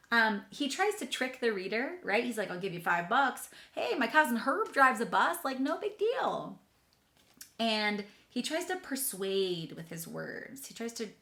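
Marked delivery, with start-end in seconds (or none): 2.5-6.6 higher, louder wheedling bribe; sneaky pigeon. Acted. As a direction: sell it like a sly little hustler. Pigeon bribing the reader.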